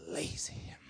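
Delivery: "lazy" whispered low after the spelling; a whispered one-word punchline. Shown as a demonstration. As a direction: drop "lazy" to a breathy whisper. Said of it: "L A Z Y".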